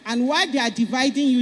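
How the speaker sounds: normal talking voice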